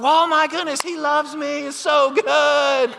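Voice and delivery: giddy delight. Retold or imitated. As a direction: squeal it like a thrilled new convert. New believer gushing, voiced.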